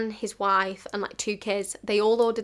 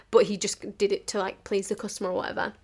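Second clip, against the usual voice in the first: usual speaking voice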